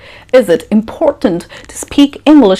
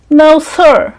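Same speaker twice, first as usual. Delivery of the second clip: high, sheepish admission to the interviewer; imitated interviewee, pitched well up; mock job candidate; 7 semitones higher than usual. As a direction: admit it in a higher, put-on candidate voice. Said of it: "You go for an interview".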